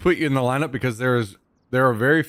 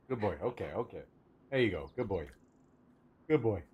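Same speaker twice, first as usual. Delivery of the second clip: much softer, lower, gentle praise; soothing the dog; 4 semitones lower, 12 dB quieter, faster than usual. Real, not acted. Streamer softly praises her dog as it takes the fry.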